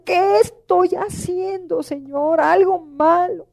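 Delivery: slow, drawn-out plea; anguished self-questioning. Retold or imitated. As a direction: stretch out the troubled question to God. Retold self-questioning plea to God. (súplica angustiada y alargada)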